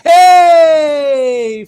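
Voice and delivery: high, loud cheer; excited cheer. Real, not acted. Game master cheering a player's natural twenty, loud joyful shout. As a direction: shout for joy at the roll.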